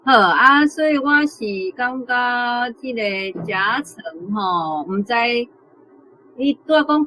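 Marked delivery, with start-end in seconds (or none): none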